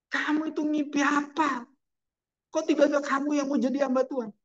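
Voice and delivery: high, baffled disbelief; a wife who can't believe what she hears; bewildered wife. Acted. Preacher voicing the wife, shocked and high-pitched. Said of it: "Istrinya bingung".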